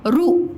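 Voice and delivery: crisp "रु" with a short u; syllable demo. Shown as a demonstration. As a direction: present "रु" clearly as the model sound. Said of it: "रु इसको बोलते हैं".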